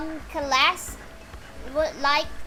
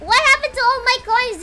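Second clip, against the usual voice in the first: dismayed outcry; 8 semitones higher, 7 dB louder than usual. Real, not acted. Coins gone after the gacha pull.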